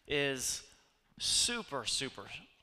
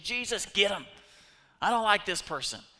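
mock-angry, louder and higher demand; frustrated prayer; 7 semitones higher, 10 dB louder than usual. Preacher mimics an angry, frustrated prayer to Jesus. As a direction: spit out a petty, annoyed prayer. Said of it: "petitions of frustration".

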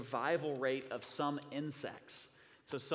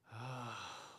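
weary sigh; 2 semitones lower, 6 dB quieter than usual. Acted. Weary sigh during prayer.

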